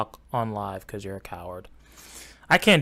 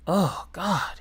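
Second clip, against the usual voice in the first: normal talking voice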